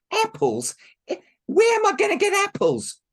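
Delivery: very high, loud disbelief; shocked girl. Acted. Marushka dismayed at stepmother's order, very high. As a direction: squeal in disbelief.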